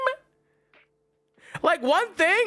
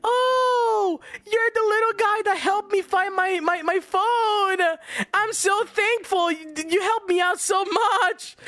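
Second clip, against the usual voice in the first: higher, gushing lady impression; imitated grateful lady; 6 semitones higher than usual. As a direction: mimic her effusive thanks in a raised voice. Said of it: "And she hits it with the".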